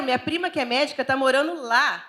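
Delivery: ordinary talk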